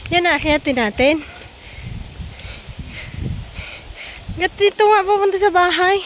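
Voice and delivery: loud outdoor calling. Real, not acted. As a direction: raise the voice over the wind. Shouting uphill on a climb. (malakas na pagtawag)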